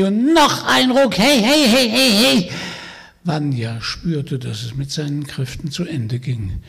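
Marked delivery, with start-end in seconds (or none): 0.0-2.5 screeching witch. Acted. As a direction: shriek the pull like a mad hag. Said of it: "Die Hexe kreischte und keifte". (kreischende Hexe, langsam und laut)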